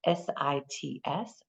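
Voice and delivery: S I T S spelled letter by letter. Shown as a demonstration. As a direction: spell the acronym out for the class. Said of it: "one of our sits".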